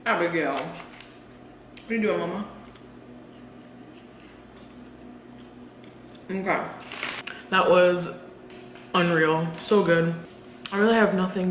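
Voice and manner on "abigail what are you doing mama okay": speaking between bites; talking while chewing. Real, not acted. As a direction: mouth partly full of food. Seen 2: Cheeks full and chewing before; talks mid-chew holding fry.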